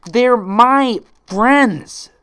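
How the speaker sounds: very high, very loud slow howl. Acted. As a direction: howl it with all your rage. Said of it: "Twilight howled".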